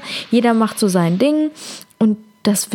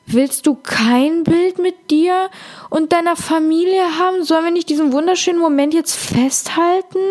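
higher, hurt wife pleading with husband; wife begging husband for a family photo, voiced higher and hurt; 5 semitones higher than usual. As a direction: act the wife, higher-pitched and wounded.

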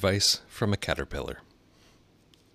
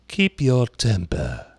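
slow, loud caterpillar voice; 4 semitones higher, 6 dB louder, much slower than usual. A narrator's reading. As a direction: drawl the advice.

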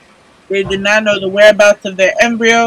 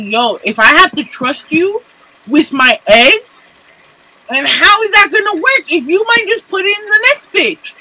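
rising exasperated yell; 9 semitones higher than usual. Retold or imitated. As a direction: voice climbs high into an outraged shout. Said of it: "I sit here and I'm like".

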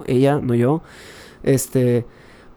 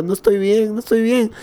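high-pitched mock crying voice; imitated tearful wail; 7 semitones higher than usual. Answering a worried grandmother in the retelling, mock tearful. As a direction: whimper it high, acting out a crying fit. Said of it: "lloraba sollozando y decía".

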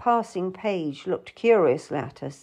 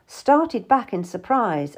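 ordinary delivery, nothing marked

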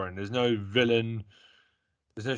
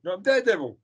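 higher, insistent claim on the hero role; playful insistence; 6 semitones higher, 3 dB louder, faster than usual. Real, not acted. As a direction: stake your claim, pitched up. Playful squabble over who is Daredevil.

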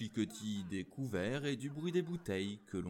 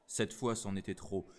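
ordinary delivery

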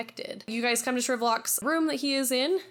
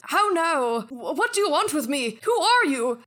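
put-on higher, louder startled old man's cry; frightened miser; 6 semitones higher, 6 dB louder than usual. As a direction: recoil in terror from the ghost. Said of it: "he goes".

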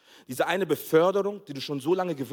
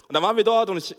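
ordinary delivery

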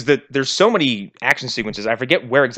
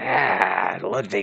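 a hint of a put-on Lambert accent, low; lightly mock the Lambert accent; 6 semitones lower, 5 dB quieter, much slower than usual. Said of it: "you Christopher Lambert?".

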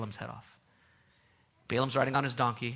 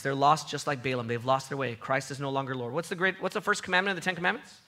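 normal talking voice

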